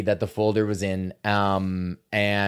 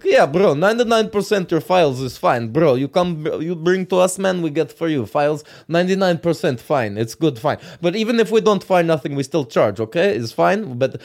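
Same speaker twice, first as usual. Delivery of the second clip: imitating a Russian tech guy, pitched up; Russian accent; 4 semitones higher than usual. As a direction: put on a pushy Russian repairman's accent. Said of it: "talking to some Russian guy who's just like".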